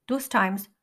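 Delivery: usual speaking voice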